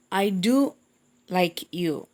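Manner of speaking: "do" drawn out, brief break before "like you"; stress on DO. Shown as a demonstration. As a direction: lean on the word "do" for emphasis. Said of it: "I'm stressing the word".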